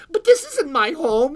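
put-on high, loud child's protest; little girl. A narrator's reading. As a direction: protest as a dismayed small girl. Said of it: "said the little girl".

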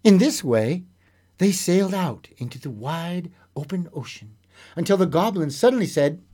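lively storyteller voice, acting out the tale; story narration. A narrator's reading. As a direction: read it like a bedtime fairy tale. Storyteller reading a fairy tale.

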